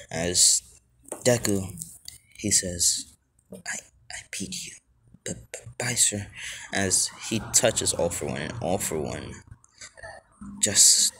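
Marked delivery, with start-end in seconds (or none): 3.5-6.3 soft, high, stuttering little voice; hushed, halting child's farewell; timid stammer. Acted. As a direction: stammer it small and whispery. Small boy stammers a farewell.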